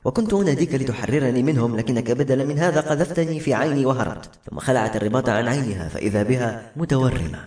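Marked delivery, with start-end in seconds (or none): none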